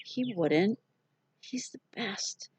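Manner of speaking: put-on hoarse, soft child voice. A narrator's reading. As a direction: rasp it out, hoarse and quiet. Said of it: "I answered in my hoarse voice".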